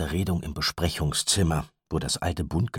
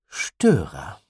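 cold and firm, not raised; calm but hard; 5 dB louder than usual. A narrator's reading. Mother warns Gideon, calm but hard. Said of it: "sprach es ruhig aus, aber ihre Stimme war härter".

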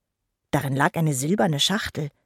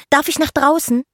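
high, louder, eager plea; excited high-pitched asking; eager child's question; 10 semitones higher, 5 dB louder than usual. Acted. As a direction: pipe up like an impatient little fairy. Eager child asks her parents.

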